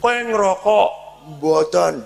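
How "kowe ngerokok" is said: higher, louder demanding question; stern repeated question. Retold or imitated. Father pressing his son about smoking, higher and sharper. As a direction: press him harder, voice rising.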